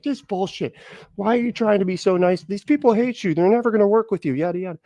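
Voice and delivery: lightly voiced fed-up partisan; a hint of an exasperated lecture; mock exasperated Democrat. Mocking what Democrats used to say.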